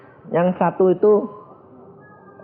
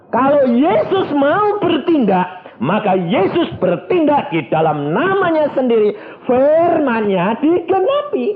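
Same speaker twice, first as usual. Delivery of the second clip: preaching; 11 semitones higher, 4 dB louder than usual. Acted. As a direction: proclaim it from the pulpit. Preaching at full force.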